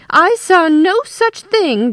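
high, loud, drawn-out indignation; snapped denial. A narrator's reading. Wife contradicts her husband, slow high indignant snap. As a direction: deny it with slow, emphatic outrage. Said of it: "Darlene snapped".

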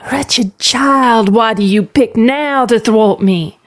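plain reading voice

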